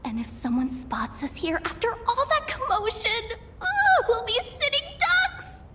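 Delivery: building to a high wail; mounting panic. Acted. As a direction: let the dread climb into a wail. Fears being caught underground.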